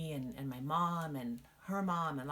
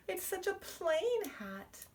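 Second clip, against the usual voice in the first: put-on high, tearful little girl; 14 semitones higher, slower than usual. Jenny opening the box, high and close to tears. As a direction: whimper out the disappointment.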